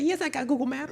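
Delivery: sing-song imitation of a viral line; mock meme quote. Joking to her friend, high put-on meme voice.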